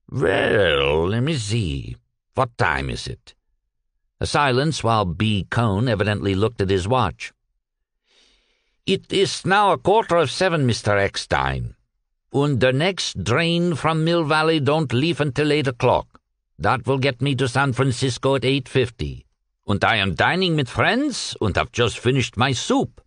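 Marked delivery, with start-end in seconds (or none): 0.1-3.3 a hint of a stalling Yiddish-accented merchant, lower; light Yiddish accent, easygoing and stalling; lightly voiced Yiddish shopkeeper. Cohn stalling on phone. 8.8-23.0 put-on light Yiddish accent, easygoing and stalling; lightly voiced Yiddish shopkeeper. Cohn explains on phone.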